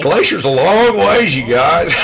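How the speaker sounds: amused, slow ribbing; laughing tease. Real, not acted. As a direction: stretch it out, breaking into real laughter. Teasing friend about changing trip.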